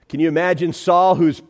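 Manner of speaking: normal talking voice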